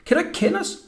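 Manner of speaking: high-pitched called question; calling out. A narrator's reading. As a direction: call it out high to the boy. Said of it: "råbte Jakob".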